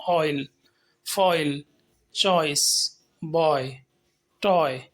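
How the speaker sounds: ऑयल, फॉइल, चॉइस, बॉय, टॉय said slowly with a clear glide; oi glide demo words. Shown as a demonstration. Teacher listing example words, careful glide. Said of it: "हमने आई की तरफ glide किया है".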